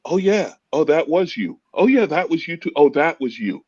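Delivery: high-pitched "oh, it's you" realization; dawning realization. Retold or imitated. Comedian recalling hearing a legend's stories, dawning realization. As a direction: let it dawn on you, pitch lifted. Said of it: "you're realizing".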